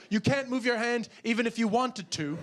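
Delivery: ordinary delivery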